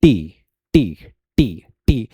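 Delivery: टी with a hard, crisp tongue-tip t; British T. Shown as a demonstration. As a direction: hit the T sharply in टी, pitch falling. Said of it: "British English में जब हम बात करते हैं". (टी में कड़क British T)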